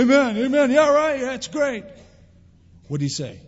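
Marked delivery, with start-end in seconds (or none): 0.0-1.8 higher, louder mock amen, yeah right; empty amen. A skimming reader answering God, hollow mock praise. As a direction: fake pious enthusiasm. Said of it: "we might say".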